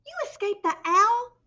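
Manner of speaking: high, loud joyful disbelief; excited cry. A narrator's reading. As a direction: cry out with joy. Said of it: "cried Stellaluna".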